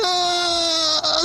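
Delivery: high, sustained cry of dismay; mock wail. Imitating wife losing her toy to the ceiling, mock crying wail. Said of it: "my wife just started crying".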